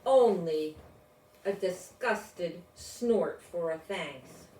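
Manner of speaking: usual speaking voice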